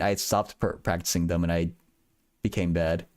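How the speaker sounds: usual speaking voice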